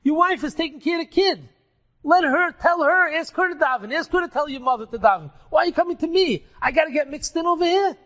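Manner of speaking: why me, throw the question back at him; man arguing he shouldn't be involved; exasperated protest. Acted. Rebbe argues with dead friend.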